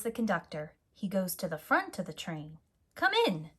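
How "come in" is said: engineer voice. A narrator's reading. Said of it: "says the engineer".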